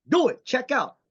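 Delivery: sudden shout. Retold or imitated. Urging a friend to act.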